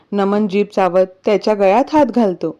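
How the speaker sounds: plain reading voice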